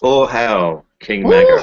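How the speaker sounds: loud slow royal chant; roaring tyrant king. Acted. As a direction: command the crowd to hail you.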